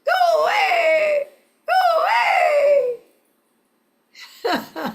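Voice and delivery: high, loud squawking bird cries; go-away bird call. Shown as a demonstration. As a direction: shout it like a grumpy bird. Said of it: "They can say".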